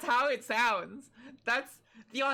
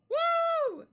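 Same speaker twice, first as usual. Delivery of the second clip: cheer; 4 dB louder than usual. Real, not acted. Cheering a donation.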